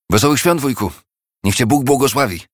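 bright high call of festive greeting; ringing cheerful greeting. A narrator's reading. Nephew bursting in, greeting his uncle with a ringing call. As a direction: burst in calling out the wishes. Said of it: "dał się nagle słyszeć dźwięczny głos".

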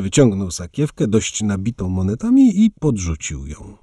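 plain reading voice

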